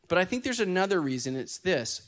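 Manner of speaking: usual speaking voice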